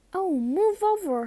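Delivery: high, loud impatient snarl. Acted. Hermione pushing Ron aside, impatient high snarl. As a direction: shove the words at him. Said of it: "Hermione snarled".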